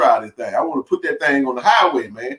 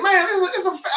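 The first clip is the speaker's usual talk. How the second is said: much higher, stammering and caught off guard; flustered apology; 3 dB quieter, faster than usual. Retold or imitated. To the mother in the SUV, embarrassed: flustered and high. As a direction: trip over the words in embarrassment.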